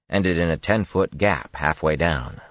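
plain reading voice

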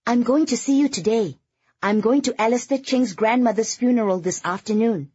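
high, loud, chirping announcement; chirpy. A narrator's reading. Girlfriend announcing her plans over speakerphone, chirpy. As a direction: chirp your news brightly, pitched well up. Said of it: "Kitty chirped".

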